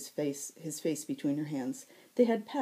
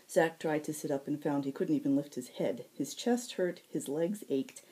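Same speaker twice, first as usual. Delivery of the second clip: usual speaking voice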